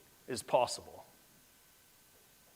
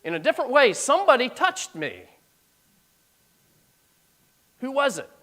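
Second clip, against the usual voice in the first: higher, louder insistent Jesus; a touch raised, searching voice; 10 semitones higher, 5 dB louder than usual. Acted. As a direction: press the question gently but firmly.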